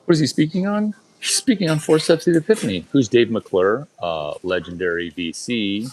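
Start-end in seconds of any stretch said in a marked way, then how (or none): 0.1-3.9 high fast mocking voice of someone else. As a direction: squeak it like a different guy. Mock other voice asking.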